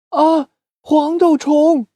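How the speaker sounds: high, slow gasp of remembering; sudden realization. Acted. Doudou suddenly remembering his friend at home, high and startled.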